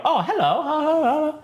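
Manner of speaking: high, fussy protocol droid; chirpy high-pitched robot greeting; C-3PO. Acted. Droid waking up and saying hello, high and fussy. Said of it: "he talks just like C three P O".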